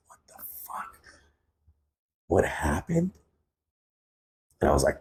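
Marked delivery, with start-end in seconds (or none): 0.1-3.1 breathy unvoiced whisper, dropping to a hush. Injured brother whispers his confusion from the hospital bed, whispered. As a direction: imitate the brother's faint, damaged-voice whisper. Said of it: "But it was in a whisper".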